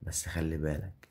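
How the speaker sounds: ordinary delivery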